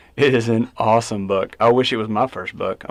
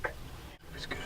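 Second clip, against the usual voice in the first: soft whispered praise, staying quiet; whispering; 9 dB quieter than usual. Real, not acted. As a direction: whisper it, keep the woods still. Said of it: "Just be real quiet".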